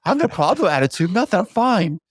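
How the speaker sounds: put-on child with attitude. Child back from a bad school day answering a parent, mock huffy. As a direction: imitate a kid's huffy denial. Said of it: "they have an attitude".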